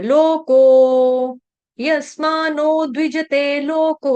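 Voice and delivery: लोको held very long with a clear k; sustained vowels through यस्मानो द्विजते लोको; verse recitation model. Shown as a demonstration. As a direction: slowly chant यस्मानो द्विजते लोको for pupils. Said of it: "इसमें क का उच्चारण करना है".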